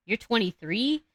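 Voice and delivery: normal talking voice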